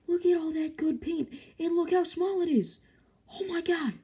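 high excited gush. Real, not acted. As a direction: squeal over how cute it is. Gushing over small figure.

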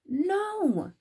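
high, drawn-out refusal; refusing Big Mouse. A narrator's reading. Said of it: "said Big Mouse".

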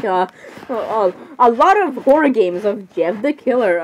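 usual speaking voice

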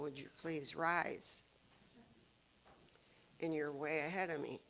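usual speaking voice

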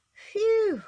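high, loud gasp of relief; big relieved puff; gasped phew. A narrator's reading. Elephant freed of its knot, gasping out a loud phew. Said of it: "gasped the elephant".

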